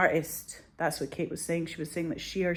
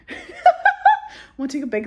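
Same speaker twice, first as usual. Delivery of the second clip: much higher, slow, laughing; 10 dB louder, slower than usual. Real, not acted. Joking about a pillow for the dentist, laughing. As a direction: squeal it through laughter.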